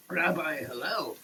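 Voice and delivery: ordinary talk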